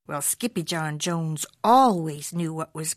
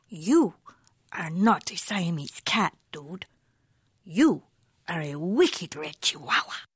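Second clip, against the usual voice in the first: put-on low, hushed Spanish accent; 3 semitones lower than usual. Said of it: "using his very best Spanish accent".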